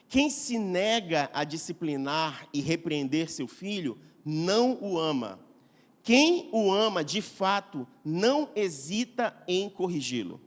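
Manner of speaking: quiet solemn reading of Proverbs; quiet scripture reading. Acted. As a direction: read the verse gently and deliberately. Reads Proverbs 13:24 aloud.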